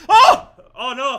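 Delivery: sudden high yelp, then a dismayed groan of words; shocked cry. Real, not acted. Fan watching the penalty go in, shocked cry. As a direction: gasp as the ball slips in. Penalty goes in past keeper.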